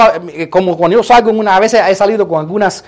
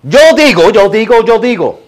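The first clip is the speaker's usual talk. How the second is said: Jesús asserting himself; 4 dB louder than usual. Acted. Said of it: "Jesús podría haber dicho".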